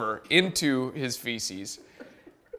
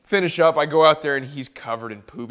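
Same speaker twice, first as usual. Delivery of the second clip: usual speaking voice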